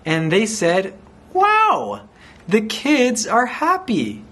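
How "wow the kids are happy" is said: high, delighted exclamation; happy amazement. A narrator's reading. The kids are happy now, delighted amazement. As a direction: marvel in a high, excited voice.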